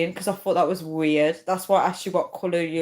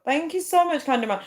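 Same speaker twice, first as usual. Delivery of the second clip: high gushing thank-you; excited thanks; 9 semitones higher than usual. Real, not acted. Streamer thanking Candyman again, high and excited. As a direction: squeal the thanks.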